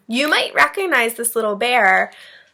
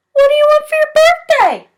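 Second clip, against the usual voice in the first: little bear voice; 12 dB louder than usual. A narrator's reading. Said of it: "said Bear".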